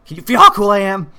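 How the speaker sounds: high, very loud, quick outburst; mock manic shout. Host playing husband shouting at his wife, manic mock yell. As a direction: burst out in hammed-up bragging.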